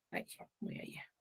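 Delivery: a quick whispered aside. Real, not acted. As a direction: mutter it under the breath as an aside. Aside while writing on board. (un susurro rápido, como aparte)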